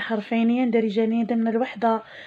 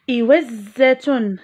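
إوزة drawn out slowly, each sound held; slow teacher model word; much slower than usual. Shown as a demonstration. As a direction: say إوزة slowly and clearly for children. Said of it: "لاحظوا معي".

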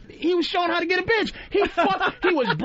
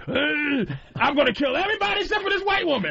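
fast rising shouted threat; mock monster shout. Kong yelling his threat at the crowd, a fast mock shout. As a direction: bark it out like a rampaging ape.

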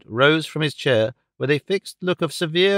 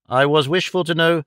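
usual speaking voice

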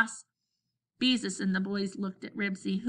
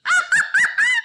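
very high, very loud guffaw; whooping laughter; 11 dB louder than usual. A narrator's reading. Boys picture the yard full of garbage, whooping laughter. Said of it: "the boys whooped some more".

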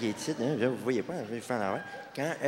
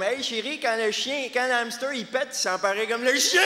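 put-on whiny dumb boyfriend; 7 semitones higher than usual. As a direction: call it out to the girlfriend, pitched way up. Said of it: "Ça va dire des affaires comme".

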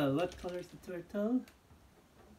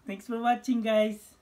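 cheerful outro; 8 semitones higher, 12 dB louder than usual. Real, not acted. Vlog outro.

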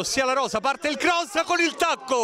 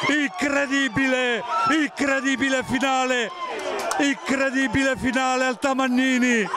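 breathless italian goal commentary. Real, not acted. Late winning goal.

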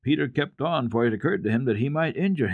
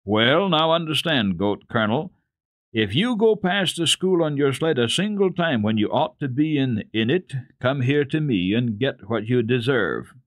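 stern warning; 5 semitones higher, 4 dB louder than usual. Acted. Uncle warns the boy.

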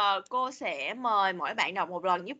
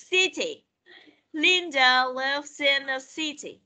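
slow, careful model reading for repetition; 3 semitones higher, slower than usual. Shown as a demonstration. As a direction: read it slowly so the class can repeat. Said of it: "Listen and repeat". (đọc mẫu chậm rãi)

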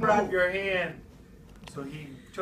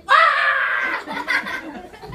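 loud, very high drawn-out shriek; sudden scream; 9 dB louder than usual. Retold or imitated. Storyteller acts out the muskrat surprise, loud high scream.

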